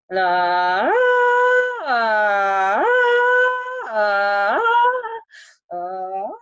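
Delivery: silly vocal slide following a rising line; silly sliding "la". Shown as a demonstration. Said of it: "I'm just gonna make silly voices".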